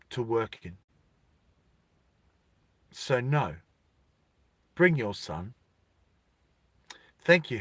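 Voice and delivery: measured and quiet, with deliberate pauses; lightly voiced official. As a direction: play a courteous bureaucrat who won't budge.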